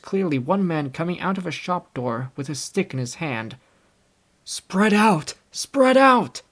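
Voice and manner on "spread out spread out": loud, high shout to the crowd; shouted cry. A narrator's reading. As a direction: yell the order to spread out. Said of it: "cried someone".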